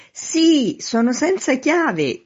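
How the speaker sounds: high, loud, stretched-out call; shouted reply. A narrator's reading. Man in street to upstairs window, shouting back. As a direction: holler it slowly up to a window. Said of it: "gridò il signor Veneranda".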